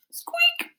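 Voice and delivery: high squeak like a mouse; squeaky voice. Shown as a demonstration. Teacher squeaking the word for children. Onomatopoeia demo.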